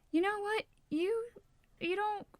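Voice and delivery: high-pitched, halting comeback; retold retort. Acting out her retort to commenter.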